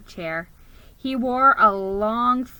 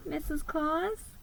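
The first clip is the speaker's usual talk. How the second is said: soft, higher and slow, a shy greeting; timid mouse voice; 6 semitones higher, 9 dB quieter than usual. Acted. Geronimo meets Santa's wife, soft and shy. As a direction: introduce yourself meekly as a little mouse. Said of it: "I said".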